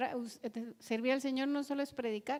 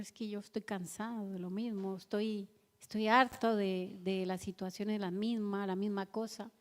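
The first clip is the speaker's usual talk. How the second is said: softer, lower, worn-out complaint; fed-up lament; 4 semitones lower, 4 dB quieter than usual. Retold or imitated. A believer complaining to the Lord, soft and weary. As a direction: sound drained and fed up.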